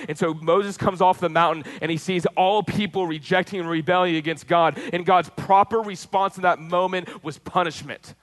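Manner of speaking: full-force preaching. Acted. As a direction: preach it with rising fervour to the congregation. Preaching Exodus story with force.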